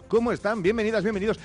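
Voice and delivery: high, loud cheerful hello; excited greeting. Real, not acted. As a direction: burst in with a bright welcome. Real voice greets after AI intro.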